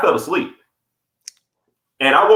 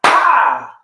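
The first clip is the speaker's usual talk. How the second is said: sudden high startled yelp, louder; startled yell; 10 semitones higher, 5 dB louder than usual. Retold or imitated. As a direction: jolt awake with a sharp cry. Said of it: "I just said".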